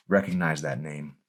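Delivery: ordinary delivery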